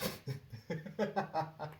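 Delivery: high, changed-voice laugh; big laugh. Real, not acted. Laughing at the coworker's quote, high pitched. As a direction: burst into laughter.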